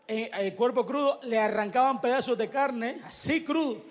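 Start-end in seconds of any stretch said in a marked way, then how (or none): none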